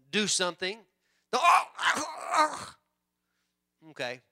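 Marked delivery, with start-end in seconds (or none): 1.3-2.8 mock flustered wife. Imitating flustered wife mistake.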